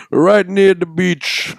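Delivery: playful drawn-out Jamaican impression; mock Jamaican accent. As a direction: stretch it out in parody Jamaican. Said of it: "make fun of the accent".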